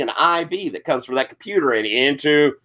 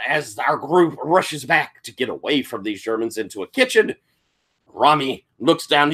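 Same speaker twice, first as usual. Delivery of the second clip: usual speaking voice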